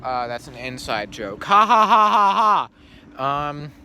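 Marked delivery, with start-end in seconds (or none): 1.4-3.5 loud cackle. Acted. Mock laugh after inside joke.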